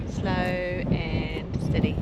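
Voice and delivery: very slow, stretched-out words; drawn-out slow. Shown as a demonstration. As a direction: spell out each word slowly like a motto. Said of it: "go slow and steady".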